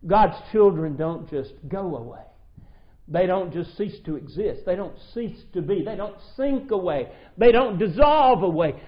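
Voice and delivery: mounting pulpit fervor; full-force preaching. Acted. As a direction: build the declaration to a ringing peak.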